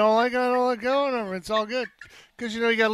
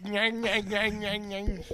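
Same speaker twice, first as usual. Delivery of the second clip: chewing noise; 5 dB quieter, much slower than usual. Retold or imitated. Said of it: "It's like".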